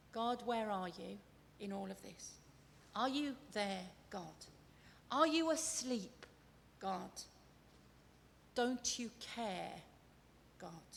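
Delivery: soft, low questions with long pauses; hushed plea, trailing off on each "God"; quiet doubting prayer. Acted. Voicing a believer's doubt in storms.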